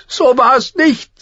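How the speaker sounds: put-on high, slow, a touch tearful girl; close to tears. Arya denies the accusation, high and slow, near tears. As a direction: hold back the tears on "nicht". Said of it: "sagte Arya und war wieder den Tränen nahe".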